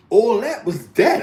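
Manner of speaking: ordinary talk, nothing marked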